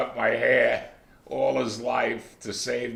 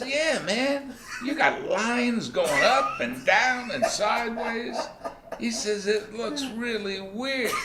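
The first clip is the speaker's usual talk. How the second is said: laughing classmate; 12 semitones higher than usual. Retold or imitated. Classmate mocking the haircut.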